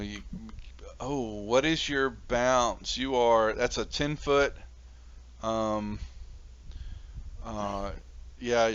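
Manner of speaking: ordinary talk, nothing marked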